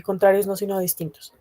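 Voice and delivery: ordinary delivery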